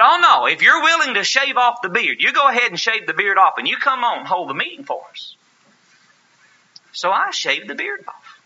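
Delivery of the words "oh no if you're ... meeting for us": eager elder. Retold or imitated.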